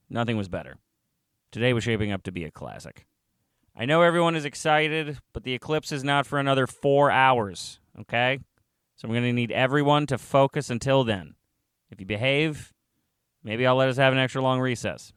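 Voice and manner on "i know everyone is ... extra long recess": higher, raised classroom voice; teacher addressing class. Acted. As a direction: project to a room of excited kids.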